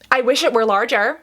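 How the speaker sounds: normal talking voice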